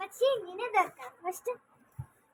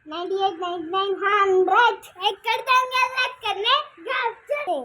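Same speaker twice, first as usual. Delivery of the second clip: shouting; 10 dB louder than usual. Real, not acted. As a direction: yell it to the whole house. Seeker finishes count, calls out. (గట్టిగా అరుపు)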